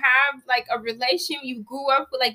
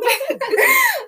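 high, loud real laugh; burst of laughter; 4 dB louder than usual. Real, not acted. Laughing at own joke.

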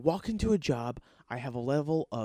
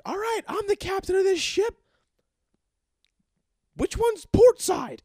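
much higher, louder hammed-up captain voice; mock cocky captain; 10 dB louder than usual. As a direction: play the clueless new guy as a pompous ship captain. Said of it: "and just been like".

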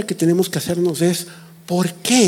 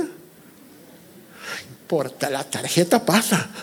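whispering; 3 semitones lower, 9 dB quieter, slower than usual. Acted. Voicing a euphoric shopper.

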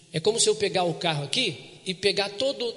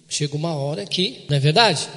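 ordinary talk, nothing marked

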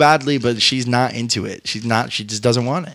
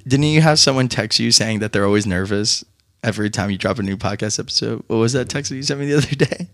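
ordinary talk, nothing marked

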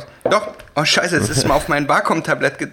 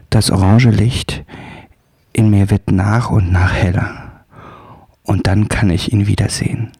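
close-mic sultry read; 5 semitones lower than usual. Shown as a demonstration. Demonstrating the close-mic intimate reading style for the friend. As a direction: lean into the mic and breathe the words low. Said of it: "ganz nah ans Mikrofon geht und leise spricht".

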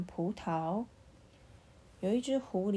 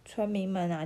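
plain reading voice

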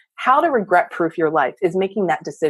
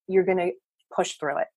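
ordinary delivery

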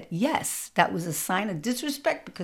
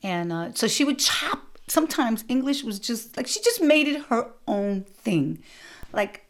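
ordinary talk, nothing marked

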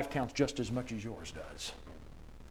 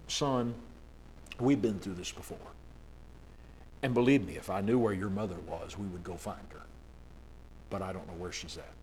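softer, lower, gentle and patient; tender explanation; 4 semitones lower, 7 dB quieter than usual. A narrator's reading. Father gently answering his grieving son, soft and tender. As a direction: ease it to the boy gently. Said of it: "The father tenderly explained".

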